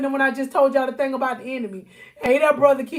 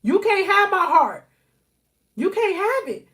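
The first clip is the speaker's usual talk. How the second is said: firm, higher, defiant no to the enemy; a touch of defiance, pitched up; defiant refusal; 5 semitones higher than usual. Pretend. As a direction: shut the enemy out, raised and firm. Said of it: "You gotta be like".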